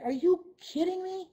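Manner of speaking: high, disbelieving squeak; mock outrage, voice jumping up high; incredulous. Staff member to coach, acting shocked and incredulous. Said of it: "And they're like".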